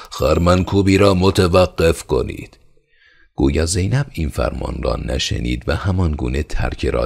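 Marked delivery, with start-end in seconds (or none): 0.1-2.6 loud, high shouted command; roaring order. Acted. Steward roaring to halt the threshing. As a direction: bellow the order to stop. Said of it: "نعره زد و گفت".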